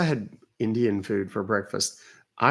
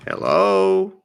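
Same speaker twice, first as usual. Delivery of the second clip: calling hello; 5 semitones higher, 5 dB louder, much slower than usual. Real, not acted. Answering a call, no reply.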